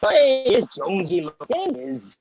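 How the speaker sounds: high, slow and delighted welcome with a big lift on the name; excited greeting. Real, not acted. Greeting Jonesy as he joins the live chat, high and delighted. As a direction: call out happily to a friend arriving. Greeting a friend joining the stream.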